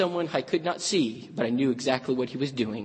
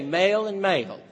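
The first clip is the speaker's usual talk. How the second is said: both words said "male", Tennessee vowel; Southern drawl, male for Mel; 5 semitones higher than usual. Retold or imitated. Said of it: "And she say".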